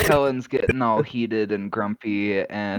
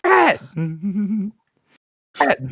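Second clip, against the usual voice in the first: calling out; 6 semitones higher than usual. Acted. Pounding on door, calling out.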